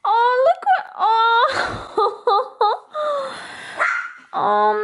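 high, welling-up voice; touched almost to tears by a gift; happy-tearful, pitched high; tearfully moved. Real, not acted. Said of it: "it may be a little bit emotional".

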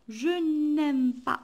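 teacher spelling out the negative slowly; slow negation. Shown as a demonstration. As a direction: draw out each word of "Je n'aime pas". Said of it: "non, ça veut dire quoi?".

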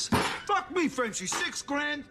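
high, incredulous yelling; outraged disbelief. Acted. Furious at his partner for selling his car for six grand, yelling in disbelief. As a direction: shout it out in shock, slowing on the rims line.

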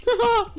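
high, far-off mock cackle; mocking laugh. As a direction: cackle in gleeful mockery. (gúnyos, magas kacagás)

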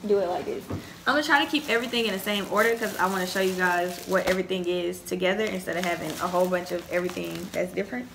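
ordinary talk

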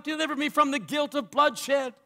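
high, anguished preacher's plea; acted cry of guilt from the pulpit. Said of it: "Then he cried out".

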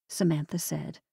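ordinary delivery

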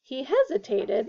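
plain reading voice

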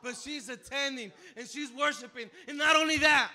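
loud, high, beaming with joy; excitedly telling the church; joyful news. Real, not acted. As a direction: share the good news with delight. Joyful announcement of mother's recovery.

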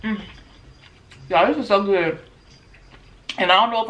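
talking while eating eggs; chewing between words; mouth full, chewing. Real, not acted. Seen 0: Eggs go in at -1; she chews while talking until ~3.8.

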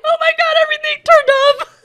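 high, fast mock panic; retold panic. Retelling the moment the computer shut off, high mock panic. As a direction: squeal it like the screen just died. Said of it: "I was like".

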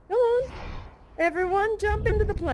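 high, eager summons; rallying call. Acted. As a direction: call the whole crowd along. Rallying everyone to jump aboard.

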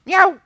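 high-pitched comic meow; imitated cat meow. Dog proving to the employer it is bilingual, imitated meow. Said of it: "lo estamos buscando bilingüe".

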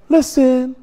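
high-pitched "listen". Shown as a demonstration. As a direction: call out "listen" to a neighbor. First take, before [name] voice.

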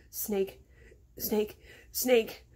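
understated trembling repetition, breaths between; rattled, mounting panic. A narrator's reading. Boy frozen before a snake, mildly panicked and rattling. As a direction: repeat it as if starting to shake. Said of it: "his body began shaking more".

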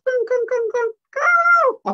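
very high, loud repeated squeals; insistent monkey. Acted. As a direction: squeal while tugging someone along. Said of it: "he just says".

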